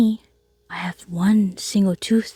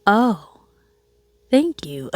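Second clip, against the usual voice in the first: shocked thanks; 3 semitones higher, much slower than usual. Retold or imitated. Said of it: "pretty clear that I was shocked".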